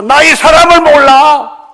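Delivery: full-force shouted oath, very high; 베드로. Acted. Peter cursing and swearing to his accusers, shouted denial. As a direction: bellow the denial, drawing it out. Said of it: "저주하고 맹세하면서".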